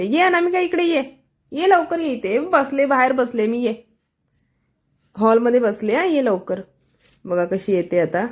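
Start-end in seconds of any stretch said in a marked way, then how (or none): 0.0-6.6 high sing-song calling out to Anamika; calling the girl, high. Retold or imitated. Vlogger calling the girl to come sit beside her in a high call. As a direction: call her over in a high, bright-pitched voice. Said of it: "अनामिका ओरडते".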